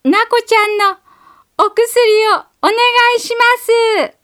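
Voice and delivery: put-on a small child shouting up to a high window; high child voice calling out, fast; little girl calling out. A narrator's reading. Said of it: "大きな声で言いました".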